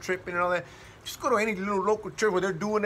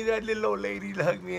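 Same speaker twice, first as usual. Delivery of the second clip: normal talking voice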